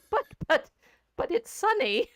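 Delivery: high, bewildered protest; baffled protest. Retold or imitated. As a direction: squeak out a puzzled objection. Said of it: "And I'm like".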